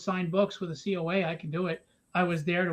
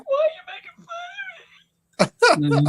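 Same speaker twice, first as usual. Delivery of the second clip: high, slow, whimpering mock crying; mock a sobbing snowflake; whiny crybaby voice; 8 dB louder, slower than usual. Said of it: "We're like".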